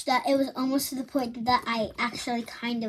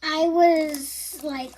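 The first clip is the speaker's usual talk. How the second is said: usual speaking voice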